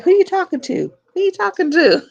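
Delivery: loud, high-pitched retold "who's that" challenge; mock challenge. Imitating people questioning who she's talking to, mock challenge. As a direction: pipe up loudly, imitating someone demanding an answer.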